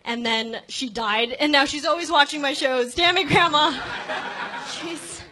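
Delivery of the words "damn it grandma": mock outburst. Joking curse at dead grandma.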